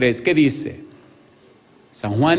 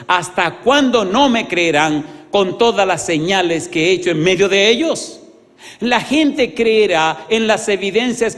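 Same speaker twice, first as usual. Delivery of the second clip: rising, louder pulpit fervor; building sermon climax, louder and higher; fervent preaching; 3 semitones higher, 6 dB louder than usual. Acted. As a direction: drive each line home to the congregation. Preaches the point at full force.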